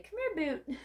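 high-pitched pet-calling voice; talking to a cat. Acted. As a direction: call a cat over in a high coo. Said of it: "to, like, any cat".

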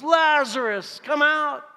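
high, full-voiced commanding shout; loud shout. Preacher as Jesus calls the dead Lazarus out, loud shout. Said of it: "he shouted in a loud voice".

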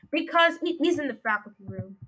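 ordinary talk, nothing marked

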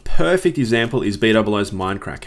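usual speaking voice

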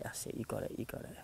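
ordinary talk, nothing marked

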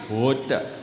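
"water" low, with crisp t and no r; proud South African pronunciation. Shown as a demonstration.

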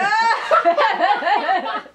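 burst of laughter. Real, not acted. Solemn face game, breaks.

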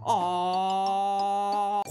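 wailing aah; crying aah. Shown as a demonstration. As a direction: let out a tearful aah. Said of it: "困った時は".

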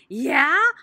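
high, loud eager yeah; hollered. Acted. Junie B hollering about the fruitcake. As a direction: holler it with delight. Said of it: "I hollered".

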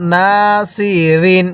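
long held "না", then "সি, রিন"; drawn-out vowel. Shown as a demonstration. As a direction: stretch "না" before "সি, রিন". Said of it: "এরকমভাবে শিখব".

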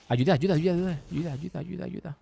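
high repeated cry for help, fading away; playful panicked pleading. Pretend. Gamer begging for help against skeletons, playfully panicked. Calls for help in game danger.

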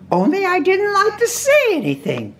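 very high character voice; Rabbit. A narrator's reading. Rabbit answering his guest Pooh, very high voice. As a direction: pitch it way up, small and proper.

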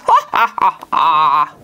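stagey gloating villain laugh; villain cackle. Acted. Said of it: "Cut the cackle".

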